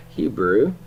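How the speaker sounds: ordinary talk, nothing marked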